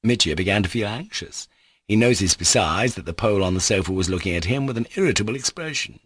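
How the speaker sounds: plain reading voice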